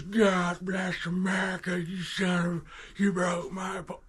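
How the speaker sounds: put-on high, slow, enraged bellowing at the kid; red-faced dad screaming over his fishing pole; furious yell. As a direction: roar it like a raging father chasing his son. Violent dad yelling over broken pole.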